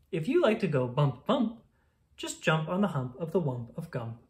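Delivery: ordinary delivery, nothing marked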